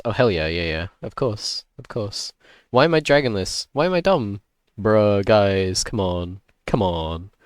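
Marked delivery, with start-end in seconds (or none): 2.7-4.4 exasperated whine. Real, not acted. Frustrated self-complaint, high.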